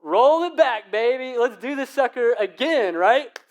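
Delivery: high, loud joyful cheer; thrilled shout. Retold or imitated. Kid at the ride's end shouting to go again. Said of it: "I'm like".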